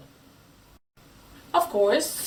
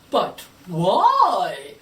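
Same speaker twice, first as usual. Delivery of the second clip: imagined listener asking; 8 dB louder than usual. Pretend. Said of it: "you may ask".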